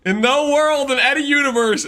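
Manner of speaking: mock-outraged rebuttal to chat; emphatic. Real, not acted. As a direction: hammer each phrase home.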